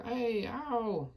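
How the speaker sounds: high yelping ow of a dreaming giant; high pained yelps. A narrator's reading. Tormented giant yelps in his sleep, high and pained. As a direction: cry out high, hurt. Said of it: "roared the flesh lump eater".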